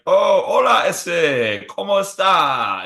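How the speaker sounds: high, loud buddy-buddy Spanish greeting. Pretend. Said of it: "be like".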